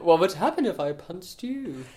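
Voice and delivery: high, mock-threatening quote with a laugh. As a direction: repeat the weak threat in scorn. Mocking the weak threat quote.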